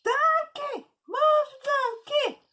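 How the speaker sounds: very high, loud, slow yell; rabbit shouting. Acted. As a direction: stretch the shout out at the donkey. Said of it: "the rabbit shouted out loud".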